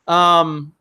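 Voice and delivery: ordinary delivery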